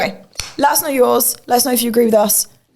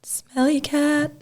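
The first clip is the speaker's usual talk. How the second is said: husky voice; 5 semitones higher, 6 dB quieter, much slower than usual. Pretend. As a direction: drag it out in a husky voice. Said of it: "with your husky voice".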